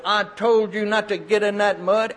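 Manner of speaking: high, emphatic mock scolding; mother's scolding. Mother grabs her muddy boy with the switch, scolding.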